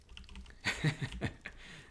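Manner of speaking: quiet breathy chuckle; amused airy laugh; soft laugh. Real, not acted. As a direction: giggle at the joke. Laughs about poisons.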